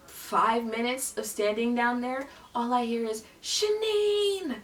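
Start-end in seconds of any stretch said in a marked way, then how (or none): 3.4-4.6 high-pitched name call; imitated screaming. Guard spotting her from atop the steps, mock screaming. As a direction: imitate hollering a name from far away. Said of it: "stop screaming my fucking name".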